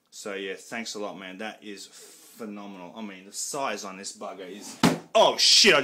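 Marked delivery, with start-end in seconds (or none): 5.1-5.8 sudden much higher, much louder "oh shit"; startled yelp. Real, not acted. Collector drops his box set and yelps in shock. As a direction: jolt out a cry as something breaks.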